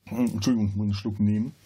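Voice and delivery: ordinary delivery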